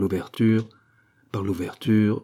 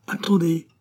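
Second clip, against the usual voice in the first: high-pitched command; raised, urgent cry; urgent calling out; 9 semitones higher than usual. A narrator's reading. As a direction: call out to wait, pressing. Crowd tells prisoner to wait.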